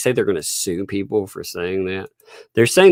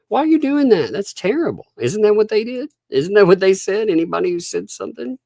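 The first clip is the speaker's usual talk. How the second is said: mock outrage; 11 semitones higher than usual. Mock-outraged questions to critics.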